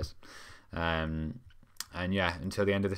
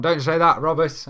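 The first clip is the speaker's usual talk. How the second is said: loud protest; 8 semitones higher, 10 dB louder than usual. Real, not acted. Protesting a viewer's gloomy comment.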